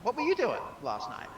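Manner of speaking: normal talking voice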